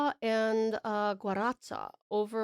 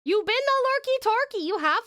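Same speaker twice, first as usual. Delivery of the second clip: high, louder teasing sing-song; squeaky teasing; 12 semitones higher, 5 dB louder than usual. Real, not acted. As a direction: tease them with a high squeak. Teasing pet name to viewer.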